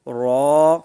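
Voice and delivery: a thick, held را; heavy drawn-out ra. Shown as a demonstration. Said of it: "اس کو بھی موٹا پڑھیں گے". (موٹا اور لمبا را)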